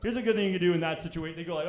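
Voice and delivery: usual speaking voice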